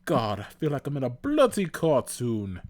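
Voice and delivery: high, loud British exasperation; British accent. A narrator's reading. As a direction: complain loudly in a put-on British accent. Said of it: "you and your British accent".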